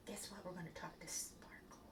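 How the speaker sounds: hushed aside to the viewers; whisper. Real, not acted. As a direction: whisper it so the puppet won't hear. Aside to viewers during puppet show.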